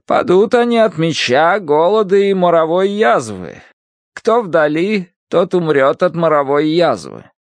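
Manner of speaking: high, forceful doom-laden scripture; stern Bible-reading woman. Acted. Woman back in the Old Testament, menacing high declaiming. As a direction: declaim the plague verse, pitched well up.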